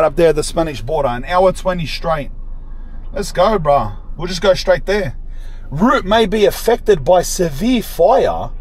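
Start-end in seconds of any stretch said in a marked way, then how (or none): none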